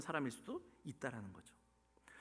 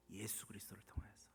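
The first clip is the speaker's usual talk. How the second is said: low, very soft, near whisper; hushed answer; 7 semitones lower, 16 dB quieter than usual. Real, not acted. Preacher answering through whom God loves, hushed. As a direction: drop the answer almost to a whisper.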